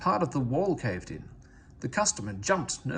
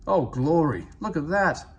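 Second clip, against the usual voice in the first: louder startled cry; alarmed exclamation; 3 semitones higher, 6 dB louder than usual. A narrator's reading. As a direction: blurt it out in shock. Fireman sees oncoming train.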